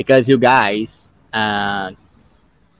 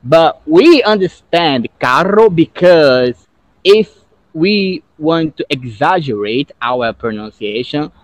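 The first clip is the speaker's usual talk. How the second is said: ordinary delivery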